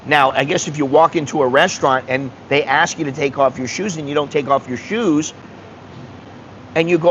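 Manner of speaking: normal talking voice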